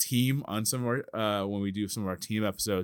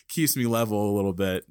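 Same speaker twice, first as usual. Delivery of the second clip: normal talking voice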